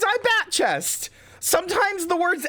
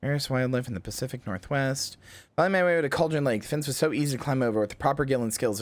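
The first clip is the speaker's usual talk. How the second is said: journal reading; 4 semitones lower, 4 dB quieter, faster than usual. Acted. Reads nature-log notes aloud.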